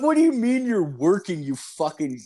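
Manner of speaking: high-pitched indignant retold rant; snapping, outraged. Customer to unhelpful store worker, high outraged rant. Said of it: "I fucking snapped".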